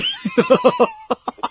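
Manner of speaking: high-pitched cackling laugh; prankster's cackle. Acted. Prankster teasing uncle over breakups, high cackle.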